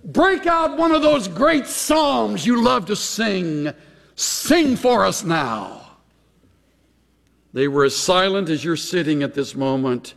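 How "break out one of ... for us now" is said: high-pitched taunting request; sarcastic. Acted. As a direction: goad the captives with mock enthusiasm. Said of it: "Can you just hear the sarcasm?".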